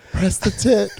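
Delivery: high, barely holding back a laugh; giggly line. Acted. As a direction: say it while about to crack up. Giggling through the acted call.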